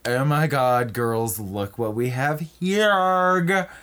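drawn-out catty mock delivery; mean queen bee. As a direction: stretch it out like a bitchy clique leader. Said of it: "basically the mean bitches of".

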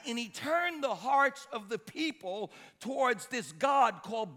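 high, loud pulpit storytelling with punched key words; full-force preaching. Real, not acted. Preacher telling the congregation about wicked Ahab, full-force preaching.

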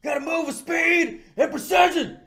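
high, loud commanding yell; hammed-up shouting boss; shouting boss impression. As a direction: bark orders like a drill sergeant.

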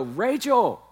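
high, loud, slow teasing call to his wife; hammed-up playful yell across the room; mock shouting joke. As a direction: ham it up as if shipping the kid off. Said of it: "I go".

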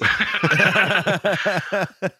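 high-pitched giggle; a bright burst of laughter. Real, not acted. Co-host cracks up at the basket joke, high giggle.

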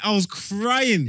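CRYING stretched long and pitched high; emphatic insistence. Real, not acted. Proving the voice note was genuine, CRYING drawn out emphatically.

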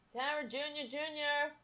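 high, soft coaxing call; cat-calling voice. Real, not acted. Owner coaxing her cat, high and soft. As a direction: sweet-talk the cat over.

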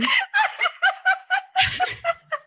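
long, high helpless laughter; real laughing fit. Real, not acted. Host can't help laughing at co-host's pain, high giggling fit. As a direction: laugh helplessly, unable to stop.

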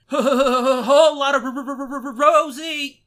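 loud stuttering with laughs; mock stutter, forced out loud; imitating a stutterer. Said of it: "they don't sing and they're like".

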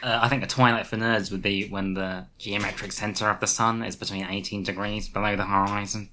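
a hint of a nerdy know-it-all; lightly voiced pedantic nerd. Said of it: "I like how you did a nerd voice".

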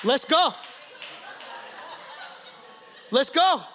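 punchy, playful dare; mock fighting challenge. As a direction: throw down a challenge. Acts out challenging God to fight.

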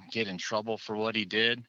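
usual speaking voice

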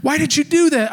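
put-on high, whiny kid voice protesting; upset five-year-old son. Dad imitating his son upset over the shell. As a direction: play the outraged little boy. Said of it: "He was like".

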